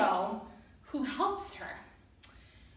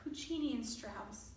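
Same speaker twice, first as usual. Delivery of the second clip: plain reading voice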